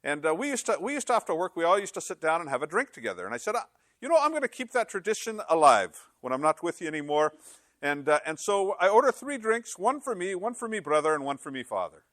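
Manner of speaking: high, lilting Irish brogue; joke-telling Irishman with a high brogue. Acted. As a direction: tell it in a put-on Irish brogue. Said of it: "I gotta say this in a brogue".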